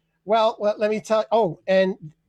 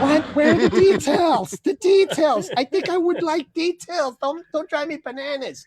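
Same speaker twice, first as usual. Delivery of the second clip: high, exasperated plea for details; exasperated rant; 7 semitones higher than usual. Acted. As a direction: beg for details, fed up. Ranting at vague people.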